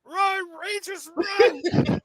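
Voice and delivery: very high, slow falsetto cry; mock panicked call. Instructor fleeing bees, telling Rangers to run, mock panic. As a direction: stretch each word in comic panic.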